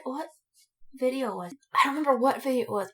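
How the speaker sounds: ordinary talk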